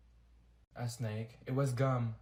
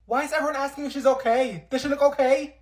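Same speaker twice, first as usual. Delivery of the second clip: louder, higher exasperated outburst; 6 semitones higher, 6 dB louder than usual. Acted. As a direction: snap at the gawking crowd. Exasperated at onlookers.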